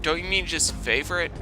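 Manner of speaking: ordinary talk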